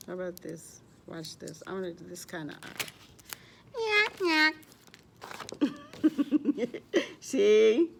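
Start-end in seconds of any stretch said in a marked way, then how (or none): 3.8-7.0 silly crazy noise. Pretend. Making crazy eyes on fish.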